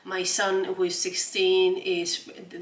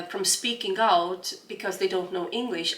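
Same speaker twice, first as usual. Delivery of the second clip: ordinary talk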